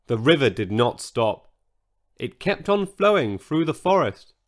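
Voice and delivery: plain reading voice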